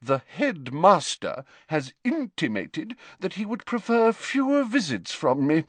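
haughty, high-pitched formality; cold. A narrator's reading. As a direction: state the slight with icy dignity. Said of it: "she said coldly".

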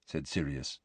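ordinary delivery

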